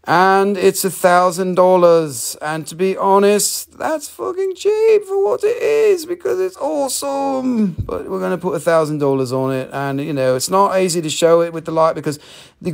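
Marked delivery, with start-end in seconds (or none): 3.8-7.7 excited. Real, not acted. Hyping the price.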